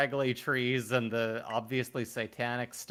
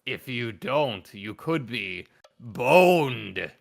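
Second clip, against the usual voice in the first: slow spooky voice; 8 dB louder, much slower than usual. Pretend. Mimicking ghostly game voice.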